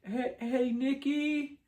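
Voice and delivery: high, nervous, slow boyfriend imitation; high mock boyfriend voice. Boyfriend nervously telling Nikki about boys' night, high mock voice. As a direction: pitch way up and tiptoe around her. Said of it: "He's like".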